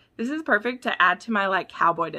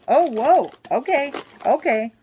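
higher, louder startled whoa; surprised; 6 semitones higher, 6 dB louder than usual. Real, not acted. Fan opening a book package from a viewer, surprised. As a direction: react to the surprise.

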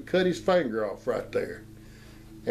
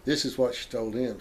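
usual speaking voice

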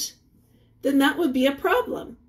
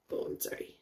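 whispered apology; 17 dB quieter, much faster than usual. Real, not acted. Teacher apologising mid lesson.

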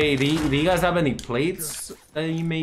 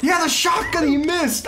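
raging gamer. Real, not acted. Ranting after death.

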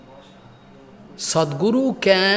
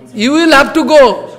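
higher and louder, a guru's order; firm command; 6 semitones higher, 5 dB louder than usual. Retold or imitated. Guru ordering his weeping disciple, in a loud firm command. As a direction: lay down the order firmly. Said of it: "Prabhupada saying".

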